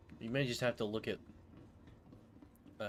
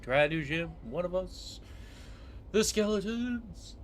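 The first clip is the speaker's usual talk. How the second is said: higher, louder, slow spooky call; playful spooky voice; 8 semitones higher, 8 dB louder, slower than usual. Pretend. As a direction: drawl it like a ghoul.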